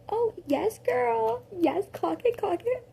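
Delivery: high, excited sassy cheer; sassy hype. Retold or imitated. Narrator cheering the listener on in the fight, high and sassy. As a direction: hype her like a best friend. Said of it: "Not clock it, sorry".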